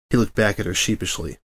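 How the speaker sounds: plain reading voice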